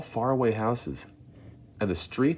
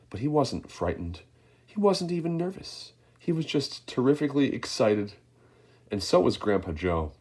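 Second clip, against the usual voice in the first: plain reading voice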